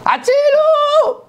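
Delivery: long, drawn-out cry for help, pitched very high; desperate shout for help. Retold or imitated. Man hanging from a cliff screams for help, high and drawn out. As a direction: hang on the word and wail it out slowly. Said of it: "צועק".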